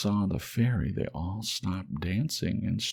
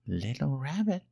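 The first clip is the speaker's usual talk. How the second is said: gentle fairy voice; 8 semitones higher than usual. Acted. Fairy comforting the lonely toy rabbit, high and gentle. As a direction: speak as a kind fairy, lifted high.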